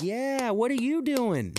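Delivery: high, slow taunting question; mock challenge. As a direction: throw it back at him teasingly. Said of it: "I was like".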